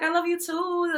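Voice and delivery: high, sweet and cute. Retold or imitated. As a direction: coo it like a loving big sister. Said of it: "You even put on a sweet, cute little voice".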